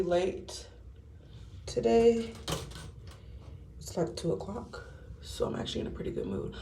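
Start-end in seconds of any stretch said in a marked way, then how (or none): none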